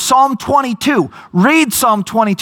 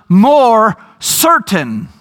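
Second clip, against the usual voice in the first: slow, emphatic "more certain"; stress on more certain; much slower than usual. Real, not acted. Preacher drives home scripture over experience, slow emphasis. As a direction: lean hard on "more".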